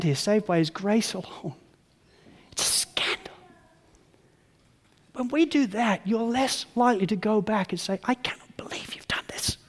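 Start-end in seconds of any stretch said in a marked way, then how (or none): 2.5-3.3 hushed unvoiced whisper. Preacher to congregation, whispered aside. Preacher stresses scandalous grace. 8.0-9.6 soft whispered disbelief; awed whisper. Acted. Preacher voicing a thankful believer, whispering. Quoted amazed response to God.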